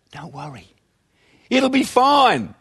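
sing-song empty comfort; glib reassurance. Retold or imitated. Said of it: "the best they can say is".

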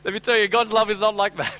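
high, loud impassioned sermon; full-force preaching. Real, not acted. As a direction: preach it out with urgency.

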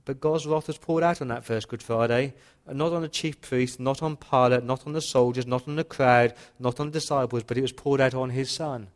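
loud, driving sermon voice with hammered repetitions; fiery pulpit delivery; full-force preaching. Acted. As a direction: preach it out, building with each line.

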